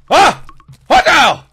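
high, loud startled questions; shocked yelling. Real, not acted. Player startled by a sudden scene change, high and loud. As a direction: shout in disbelief. Sudden confusing game event.

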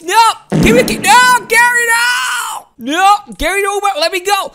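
loud, high wails with drawn-out names; hammed-up begging to be let go; playful panicked shouting. Caught by Gary in the game.

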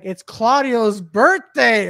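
host announcing. Retold or imitated. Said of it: "the host is like".

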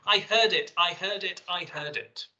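high and loud at first, settling with each repeat; beaming, thrilled repetition, read from notes; imitated delight. Reading the actor's reaction to his breakthrough, imitated delight. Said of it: "Wood beaming and grinning".

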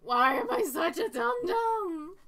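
silly whine. Real, not acted. Self-scolding.

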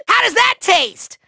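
ordinary talk

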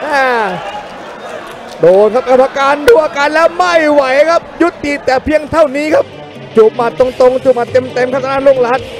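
high-pitched rising excitement; excited knockout call. Real, not acted. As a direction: call the stoppage at a peak. Knockout called live. (พากย์ช่วงน็อกอย่างตื่นเต้นสุดขีด)

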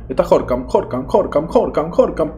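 live gamer urging his striker; excited urging. Real, not acted. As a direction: egg him on, pitch climbing. Urging a player to shoot.

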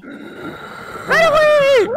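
very high, slow panicked cry; panicked squeal. Pretend. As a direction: shriek it while fleeing in terror. Character panics, fleeing.

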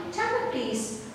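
ordinary talk, nothing marked

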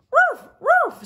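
very high, loud yappy bark; barking dog. Acted. Teacher voicing the dog, high loud bark. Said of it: "said dog".

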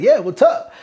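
high, loud eager reply; excited greeting. Retold or imitated. Soldiers greet a Dominican Brit, high excited reply. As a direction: greet a new friend with a burst of energy.

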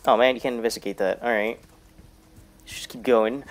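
ordinary talk

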